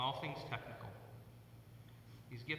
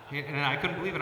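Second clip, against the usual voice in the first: louder, higher, words laughed out; laughing; 4 semitones higher, 7 dB louder than usual. Real, not acted. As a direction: let the laugh carry the line. Laughing through the memory.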